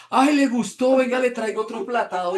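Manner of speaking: put-on high, loud, fussing old lady voice; sweet old hostess. Host voiced offering more. (señora insistente con voz aguda y fuerte)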